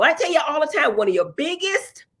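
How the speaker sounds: ordinary talk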